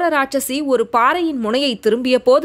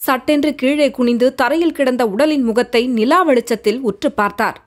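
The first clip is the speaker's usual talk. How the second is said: plain reading voice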